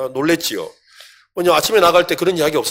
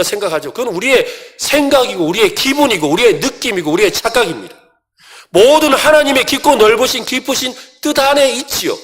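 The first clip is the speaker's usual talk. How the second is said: higher and louder, driving sermon voice; fiery preaching; 7 semitones higher, 6 dB louder than usual. Acted. Pastor preaching forcefully against Jonah's anger. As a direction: preach it hard to the congregation.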